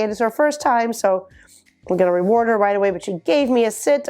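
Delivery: usual speaking voice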